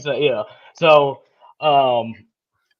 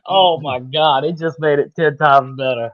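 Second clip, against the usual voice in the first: usual speaking voice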